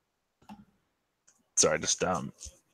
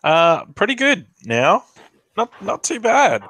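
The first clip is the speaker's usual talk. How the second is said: much higher, lilting character voice; cheerful figure in a raised, swooping pitch; upbeat Lug; 10 semitones higher than usual. Acted. Player answers as recovering Lug, high and upbeat.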